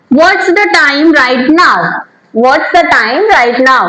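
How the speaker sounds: very slow, each word spaced; slow model sentence. Shown as a demonstration. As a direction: read it slowly for students to repeat. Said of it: "को हम बोलेंगे".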